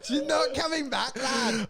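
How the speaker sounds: hammed-up punchline yell; mock Kyle shout. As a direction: toss back the punchline like Kyle. Quoting Kyle's punchline.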